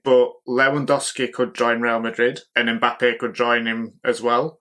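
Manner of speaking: usual speaking voice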